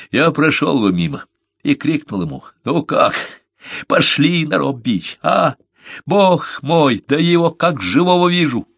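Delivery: high, fervent imagined greeting; overwrought reminiscing. A narrator's reading. Беккер imagines calling to his dead friend, overwrought. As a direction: let the voice climb as the memory takes over.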